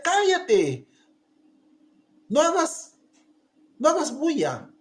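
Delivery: high, sharp hushing scold. Retold or imitated. Crowd telling the blind man to hush, sharp scold. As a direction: shut him up impatiently. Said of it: "le gritaban".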